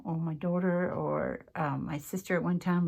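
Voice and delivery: ordinary delivery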